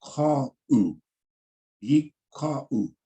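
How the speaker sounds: yeek, call u broken into separate beats; careful sounding-out of yeek, call u; syllable-by-syllable pronunciation. Shown as a demonstration. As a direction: split yeek and call u apart so students hear each part. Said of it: "The way you pronounce that with yeek".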